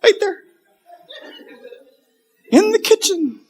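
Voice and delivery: put-on tiny high-pitched little-kid voice; meek, very high childlike reply; five-year-old voice. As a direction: squeak it like a small child. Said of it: "With a five-year-old voice".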